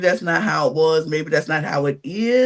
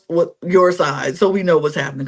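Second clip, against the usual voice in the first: ordinary talk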